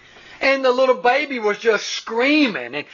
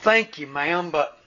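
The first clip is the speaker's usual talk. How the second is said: lower, deadpan, the reveal dropped flat; dry punchline; 6 semitones lower than usual. Retold or imitated. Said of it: "And he said".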